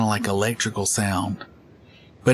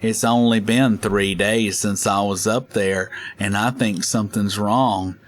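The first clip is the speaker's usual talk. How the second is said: odd slow caller; slower than usual. Retold or imitated. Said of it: "do crazy sounding stuff".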